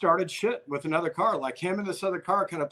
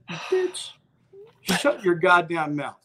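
retold angry comeback; 4 dB louder, much slower than usual. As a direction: re-enact shutting down a heckler. Snapping back at sister, retold.

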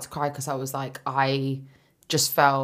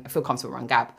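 normal talking voice

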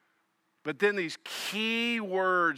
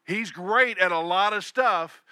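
usual speaking voice

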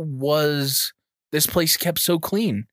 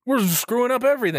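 high mock voice; 7 semitones higher than usual. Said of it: "they're like".

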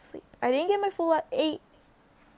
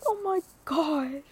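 overjoyed gasp; 4 semitones higher, slower than usual. Retold or imitated. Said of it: "it was like".

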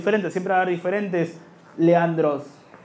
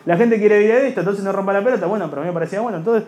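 ordinary talk, nothing marked

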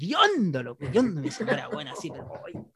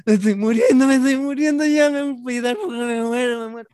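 dramatic whine; 6 semitones higher, 5 dB louder than usual. Retold or imitated. Sick drunk complains to wife.

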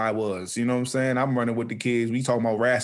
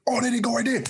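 excited shout; 12 semitones higher than usual. Retold or imitated. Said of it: "Even faster".

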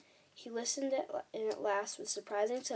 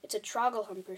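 ordinary delivery

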